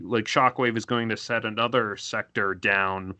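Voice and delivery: normal talking voice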